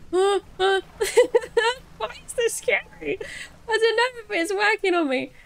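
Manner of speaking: high, loud, half-laughing alarm; nervous laughing fright. Real, not acted. Player puzzled that the game is scary, nervous laughing fright.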